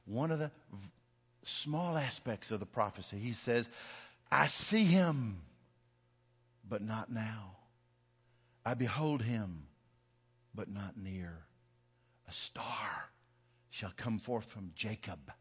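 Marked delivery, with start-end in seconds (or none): 4.3-5.4 slow, drawn-out and louder; solemn proclamation. Acted. Balaam prophesying over Israel, slow solemn proclamation. 6.7-15.3 soft, low, reverent recital; hushed prophecy. Acted. Balaam foretelling the star from Jacob, hushed and solemn.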